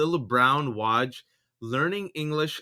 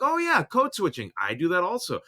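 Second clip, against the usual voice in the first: higher, airy oh yeah; mock casual agreement; 4 semitones higher than usual. People agreeing about code switching, higher casual voice. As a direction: toss it off like it's obvious. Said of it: "people are like".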